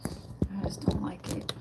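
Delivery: soft whispered complaint; whispered frustration over the setup. Real, not acted. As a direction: breathe it out, annoyed.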